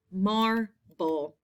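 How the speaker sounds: "mar... ble", split with a pause; syllable by syllable. Shown as a demonstration. Teacher repeating a spelling word, "Marble" split in two. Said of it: "first syllable".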